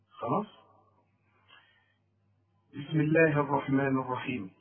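ordinary talk

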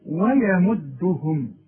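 ويمدهم slowly with thin waw and ya; thin letters. Shown as a demonstration. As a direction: draw out ويمدهم lightly. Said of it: "رقق الواو ورقق الياء". (ويمدهم مرققة وببطء)

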